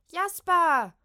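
high, very loud cry; alarmed cry. Acted. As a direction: cry out in fright. Kim stürzt erschrocken zum Vogel. (erschrocken aufschreien)

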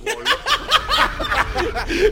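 very high, loud, long laughter; shrieking laugh. Real, not acted. Host collapses at his co-host's innuendo, high loud laughing. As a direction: laugh helplessly in a high peal.

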